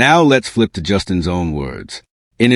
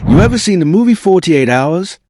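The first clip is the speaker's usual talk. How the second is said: imitating [name]; 8 semitones higher than usual. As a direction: quote [name] like a hype host. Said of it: "[name] looks dead into the camera and says".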